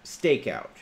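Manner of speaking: usual speaking voice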